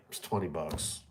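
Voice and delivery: low, very soft shrug of a line; retold resignation. Seller thinking over a small shortfall, retold quiet resignation. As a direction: let it go under your breath.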